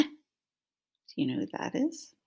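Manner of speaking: plain reading voice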